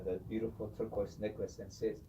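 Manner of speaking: ordinary talk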